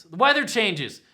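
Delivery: ordinary talk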